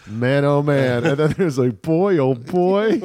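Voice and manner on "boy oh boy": put-on a cheeky, playful boy oh boy; mischievous. Shown as a demonstration. Comparing phrases, the mischievous one. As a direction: say it with a wink. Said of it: "more mischievous than the other".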